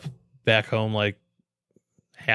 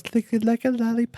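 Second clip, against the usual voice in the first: high, put-on little girl voice, much higher; mocking young girl; 10 semitones higher than usual. Host mocks young girls singing a lollipop song, high mock girl voice. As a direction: mimic a twelve-year-old girl with a high, mocking voice. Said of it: "like, twelve-year-old girls".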